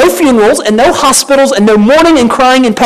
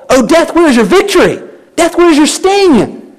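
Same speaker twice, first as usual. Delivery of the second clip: defiant taunt, pitched higher, the sting line slowed; taunting Paul; 6 semitones higher than usual. Retold or imitated. Paul taunting defeated death, mocking and triumphant. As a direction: jeer at death like a victor. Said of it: "that taunt in Paul's voice".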